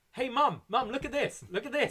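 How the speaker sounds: high, loud mock child calling his mum; whiny kid. Mocking Priest as a kid calling to mum, high and loud. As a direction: whine like a kid showing off to mum.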